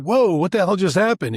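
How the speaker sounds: retelling the shock of a bold ask, surprised; stunned disbelief. Retold or imitated. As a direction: act out being caught off guard. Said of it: "you're like".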